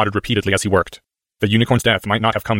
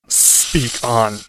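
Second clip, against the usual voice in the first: slow hypnotic serpent hiss; 2 semitones higher than usual. A narrator's reading. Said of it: "hissed the snake".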